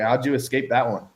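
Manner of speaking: usual speaking voice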